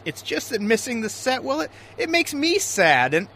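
normal talking voice

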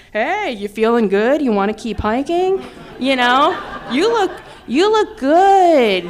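playful sing-song persuasion, stretched out; butter them up with drawn-out, swooping flattery; coaxing. Retold or imitated. Coaxing strangers to keep hiking.

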